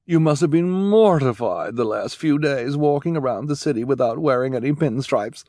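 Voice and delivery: gushing, high and loud fashion devotee; Esme. Acted. Esme raving to orphans about pinstripes, gushing.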